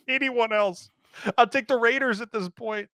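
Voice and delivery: pleading dad. Retold or imitated. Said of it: "I'm like".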